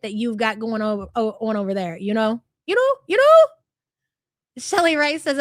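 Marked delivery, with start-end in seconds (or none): 2.7-3.5 put-on high, rising Valley girl uptalk; exaggerated Valley girl squeal of a question; mock Valley girl. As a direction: mimic a fake Valley girl, pitch leaping upward. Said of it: "that fake Valley girl bullshit".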